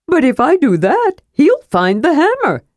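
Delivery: high-pitched kender protest; Tass arguing. A narrator's reading. Kender argues against fetching Armin, high and protesting. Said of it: "Tass argued".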